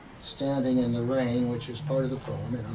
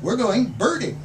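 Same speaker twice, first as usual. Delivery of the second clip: excited announcement; 10 semitones higher, 8 dB louder than usual. Acted. Narrator's eager announcement to Kathy.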